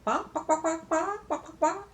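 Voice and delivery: high-pitched hen "bok bok"; chicken clucking. Retold or imitated. Grandma showing where eggs come from, clucking. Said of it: "Yeah, that's a chicken".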